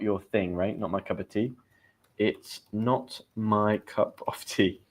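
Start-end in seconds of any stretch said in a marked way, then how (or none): 2.2-4.8 slow word-by-word teaching delivery; slow, careful. Shown as a demonstration. Teacher explaining an idiom to learners, slow and careful. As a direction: spell it out slowly, word by word.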